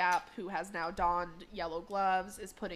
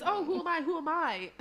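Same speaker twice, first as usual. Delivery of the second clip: put-on high, louder playful kid voice; high-pitched mock teasing; teasing child; 8 semitones higher, 5 dB louder than usual. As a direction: act out a taunting guessing game. Child character teasing before an impression.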